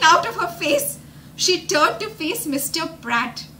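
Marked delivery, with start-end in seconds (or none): none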